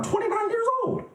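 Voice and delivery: very high squeal of hammed-up disbelief; mock disbelief. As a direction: squeak it out, scandalized. Mock outrage at the guessed age.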